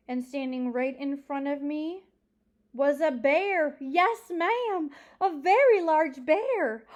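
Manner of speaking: loud, high, stretched-out reveal; dramatic old storyteller. Acted. Old librarian revealing the bear in front of her, dramatically emphatic. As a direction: build suspense, then boom out each phrase.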